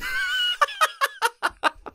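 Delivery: a high, giddy burst of laughter. Real, not acted. Gamer picking the chainsaw, high giddy laugh. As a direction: cackle with glee at your choice.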